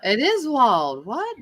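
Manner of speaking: drawn-out, disbelieving, jumping high on "What"; slow disbelief, then a high "What?"; astonished. Real, not acted. Host hearing the clip confirm it, astonished.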